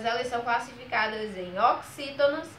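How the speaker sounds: ordinary delivery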